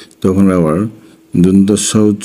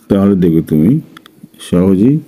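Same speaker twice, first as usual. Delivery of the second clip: ordinary talk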